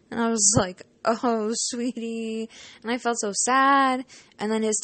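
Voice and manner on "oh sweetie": drawn-out tender pity; tender sympathy. Retold or imitated. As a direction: coo it to a sad child. Consoling a sad student.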